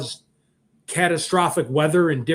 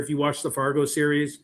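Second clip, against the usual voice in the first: ordinary talk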